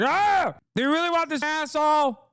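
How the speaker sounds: high, squeaky mock sputter; mock flustered Republican voice; mocking angry Republican. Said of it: "Where they're like".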